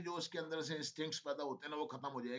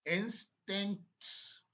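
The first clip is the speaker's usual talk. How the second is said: slow dictation; 3 semitones higher, much slower than usual. Shown as a demonstration. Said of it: "फिर से बोल रहा हूं मैं".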